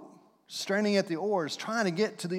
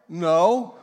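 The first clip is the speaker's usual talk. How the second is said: sulky no; 7 dB louder than usual. Acted. Acted churchgoer answering.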